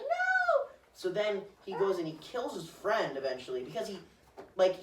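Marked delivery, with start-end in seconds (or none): 0.0-0.7 very high mock shriek. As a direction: cry out in despair. Girlfriend crying out.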